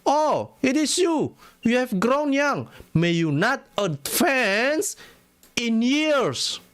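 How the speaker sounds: high, very slow, drawn-out pronouncement; slow, ominous reading of a prophet's curse; solemn curse. Acted. Reads Muhammad's curse in English.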